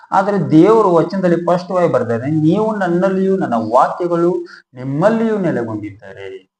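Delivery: preaching at full force. Acted.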